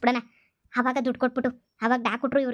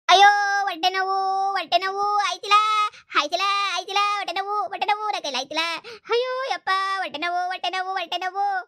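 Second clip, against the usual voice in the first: high, hammed-up groaning over a bellyache; fake stomachache wail; 5 semitones higher than usual. Shown as a demonstration. Mother-in-law demonstrates faking pain to her son, hammed-up high wailing. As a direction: ham up the pain to fool the guards. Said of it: "ನೋಡಿ ಇವಾಗ ಹೆಂಗ್ ಬಡ್ಕತೀನಿ".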